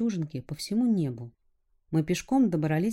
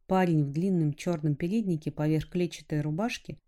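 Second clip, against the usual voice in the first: ordinary delivery, nothing marked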